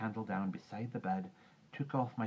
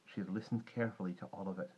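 ordinary delivery, nothing marked

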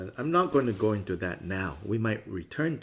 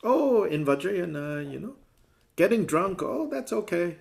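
mock permissive voice; 8 semitones higher, 7 dB louder than usual. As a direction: sing out the excuse like it's nothing. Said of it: "But it doesn't mean".

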